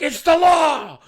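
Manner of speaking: high, loud mock-outraged yell; retold shout. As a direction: imitate an indignant rule-enforcer shouting. Acted stranger scolding a customer.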